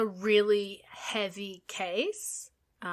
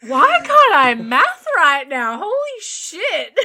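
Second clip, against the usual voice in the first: exasperated outburst; 8 semitones higher, 11 dB louder, slower than usual. Real, not acted. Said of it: "I'm trying to do math right now".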